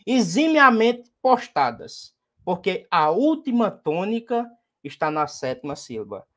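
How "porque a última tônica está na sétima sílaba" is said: ordinary talk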